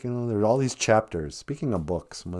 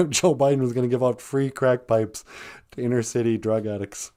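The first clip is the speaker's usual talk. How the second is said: ordinary talk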